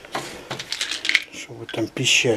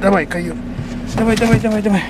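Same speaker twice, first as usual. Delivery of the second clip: urging on; 7 semitones higher than usual. Real, not acted. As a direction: hurry him up with a call. Urging dog into car.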